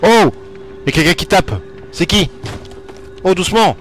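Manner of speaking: high, loud startled cries. Real, not acted. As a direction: jump at the knocking and call out. Startled by knocking in horror game. (cris surpris, aigus et forts)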